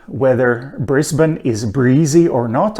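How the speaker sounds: ordinary delivery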